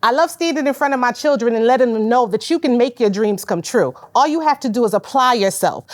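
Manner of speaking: usual speaking voice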